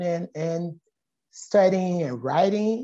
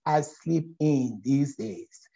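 slow calm reply; 3 semitones lower, slower than usual. Retold or imitated. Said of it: "I said".

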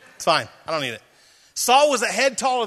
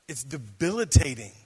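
ordinary delivery

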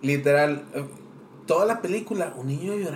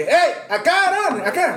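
shouted call across the cinema, high and loud; loud excited shout to a friend; 11 semitones higher, 4 dB louder than usual. Retold or imitated. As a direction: yell for your friend to come over. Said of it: "Y cuando vas entrando de que".

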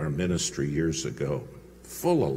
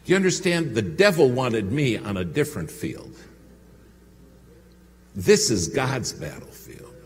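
ordinary talk

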